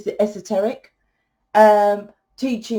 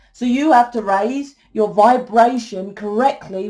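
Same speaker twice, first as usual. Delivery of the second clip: ordinary talk, nothing marked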